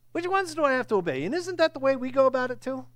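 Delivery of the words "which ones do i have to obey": wheedling questioner. Acted. Man trying to get off hook.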